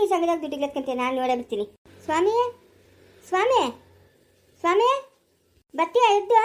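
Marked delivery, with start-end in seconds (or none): 2.0-6.4 calling her husband from the door. Acted. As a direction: call him out, name rising each time. (ಗಂಡನನ್ನು ಬಾಗಿಲಿಂದ ಕೂಗುವುದು)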